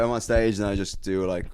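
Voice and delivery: ordinary delivery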